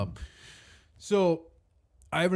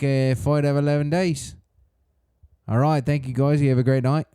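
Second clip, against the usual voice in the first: put-on Australian fighter's post-fight speech accent; Volkanovski. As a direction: talk like a humbled Aussie fighter, halting with uhs. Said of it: "That was the Volkanovski speech".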